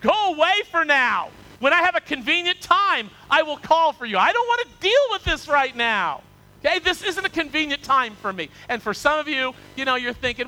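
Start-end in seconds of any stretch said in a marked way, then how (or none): 0.0-8.5 higher, louder, urgent dismissal; frightened brush-off. Acted. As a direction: push the preacher away in a panic. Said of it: "Felix was afraid and answered".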